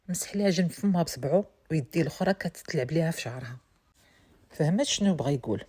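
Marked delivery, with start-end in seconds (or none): none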